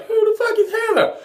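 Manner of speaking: high, loud, fast mock outrage; quoting Untucked. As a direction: squeal it like the famous Untucked line. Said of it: "like, Untucked".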